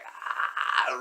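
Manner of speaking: long, drawn-out strangled "aah"; strained choking cry. Retold or imitated. Choked man reaches for his hammer, strained drawn-out cry. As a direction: stretch out a strained, voiceless yell.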